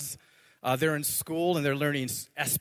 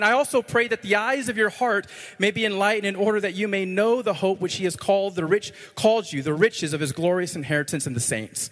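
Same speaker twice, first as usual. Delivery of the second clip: raised, driving scripture reading; 4 semitones higher, 3 dB louder than usual. Acted. Preacher reading Paul's prayer to the congregation, raised and emphatic. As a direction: read the verse out loud and high, with emphasis.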